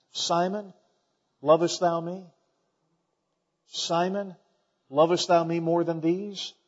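a quiet, pleading Jesus voice; tender quiet question. Acted. Jesus softly asking Peter if he loves him, tender and quiet. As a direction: softly ask Simon lovest thou me, unhurried and gentle.

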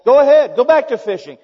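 loud, high, forceful dare; challenging. Retold or imitated. The Lord daring Peter to return to fishing, loud challenge.

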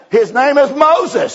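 preacher marvelling at the man's name, slow and loud; amazed. Real, not acted. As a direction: savour the surprise.

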